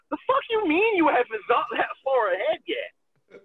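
high, disbelieving snap at friends; mock outrage. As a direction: play the outrage, half-laughing. Retold outraged reaction.

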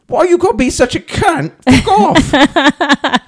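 put-on high squeaky child swearing; little girl voice. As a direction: imitate a tiny British girl cursing. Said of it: "little four-year-old girl's like".

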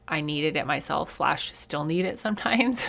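ordinary talk

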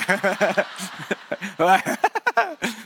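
real laugh. Real, not acted. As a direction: laugh at being compared to Mister Bean. Laughing at Mister Bean comparison.

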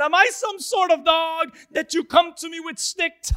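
loud, high-pitched taunt; hammed-up enemy scoffing; mocking. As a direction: jeer at a puny challenger. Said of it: "began to mock him".

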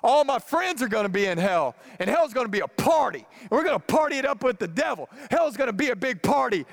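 hammed-up college kid bragging about partying in hell; mock drunk partier. Pastor imitating his younger drunk self, mock rowdy. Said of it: "we would say stupid things like this".

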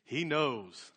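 understated triumphant crow, full voice. Retold or imitated. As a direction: savor it proudly, letting the last word fall. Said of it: "he crowed".

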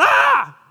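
very high, loud panicked shriek; terrified scream. Retold or imitated. Scared Peter fleeing, shrieking very high and loud. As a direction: scream like you're running for your life. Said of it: "He was just going".

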